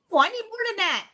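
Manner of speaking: ordinary talk, nothing marked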